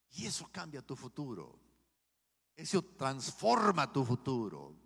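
loud, slowing, fervent proclamation; preaching at full force. Acted. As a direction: build it up and declare it. Closing preaching climax on changed future.

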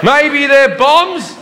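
drawn-out giggly answer; slow nervous giggle. Retold or imitated. Comedian joking with the guard, slow giggly reply. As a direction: let it out slow, half laughing.

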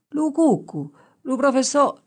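put-on high, eager dialect voice of a young shepherd; rustic shepherd answering. Young shepherd answering the professor, high and eager. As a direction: blurt it out like a naive country boy.

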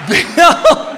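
high questioning call. Acted. Wife still searching for her husband, high questioning call.

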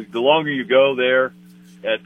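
ordinary delivery

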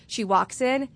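ordinary delivery

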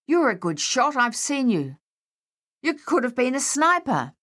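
high-pitched, urgent pressing; agitated soldier talking someone into it; urgent insistence. A narrator's reading. Unnerved Harold urgently presses Dave.

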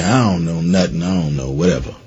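low, soft, bored mumble of someone with no plans; lazy, low-voiced imitation of an aimless person; mock aimless mumble. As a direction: shrug it off like you don't care. Aimless person answering a question.

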